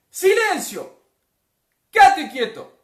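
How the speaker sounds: full-force shouted command; very loud, high order to be still; Jesús. Acted. Jesus ordering wind and sea, commanding shout. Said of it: "Jesús le pega el grito".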